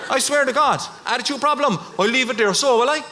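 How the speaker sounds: bouncy castle man taunts him, hammed-up; mock threat. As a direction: taunt like a bully.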